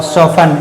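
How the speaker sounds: "सॉफ्टन" as SOF-un, no t; "सॉफ्टन" with f straight into the ending; silent t. Shown as a demonstration. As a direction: leave the t out of "सॉफ्टन". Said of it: "यानी सॉफ्टन नहीं".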